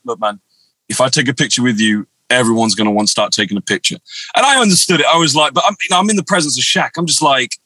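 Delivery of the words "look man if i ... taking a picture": low, composed retold reply; very calm. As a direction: quote him calmly, pitched well down. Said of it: "just very calmly, very calmly".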